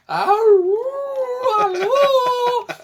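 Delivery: very high, loud hooting cries; bouncy playful with animal noises. Retold or imitated. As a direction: whoop like an excited bird. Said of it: "he's doing this hand thing".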